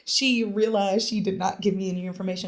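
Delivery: ordinary delivery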